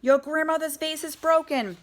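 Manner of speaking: high, loud alarmed mother's call; Hopper's mom calling up in alarm; cries out. Acted. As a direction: cry out to the boys about the vase. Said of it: "she cries".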